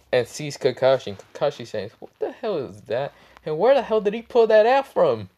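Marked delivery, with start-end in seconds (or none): 2.0-5.3 hammed-up bewilderment, climbing high and loud; incredulous high-pitched outburst; startled disbelief. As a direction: build from baffled to a high, loud exclamation. Kakashi startled by the sniper rifle.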